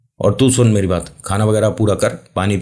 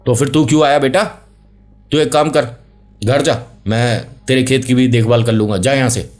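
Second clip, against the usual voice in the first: calling out; 6 semitones higher than usual. Acted. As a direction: shout the order to go home. Uncle calling to boy.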